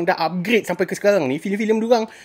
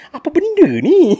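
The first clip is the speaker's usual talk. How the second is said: higher, louder, slowed incredulous what-is-this; mock disbelief; 9 semitones higher, 5 dB louder than usual. Reviewer reacting to the film's silly fight, slow mock disbelief. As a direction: drag it out, baffled and mocking.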